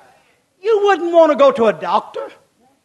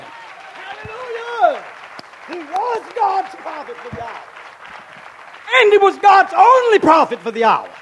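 preaching cry; 8 semitones higher, 4 dB louder than usual. Real, not acted.

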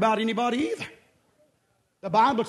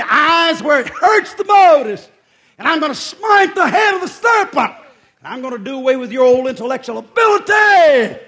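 full-force preaching; 5 semitones higher, 10 dB louder than usual. Acted. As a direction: preach it hard, roaring defiance at the enemy.

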